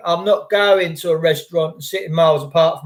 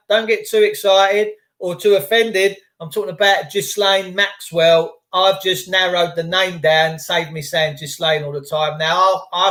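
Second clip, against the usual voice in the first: ordinary talk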